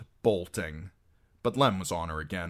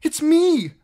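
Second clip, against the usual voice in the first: high, loud desperate shout; 14 dB louder than usual. A narrator's reading. Captive girl shouting to Harwin, high desperate shout. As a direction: shout to be recognized. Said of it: "she shouted".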